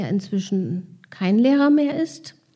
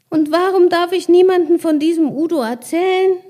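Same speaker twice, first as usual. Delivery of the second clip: put-on child voice; 7 semitones higher, 5 dB louder than usual. Child asks Carsten, puzzled.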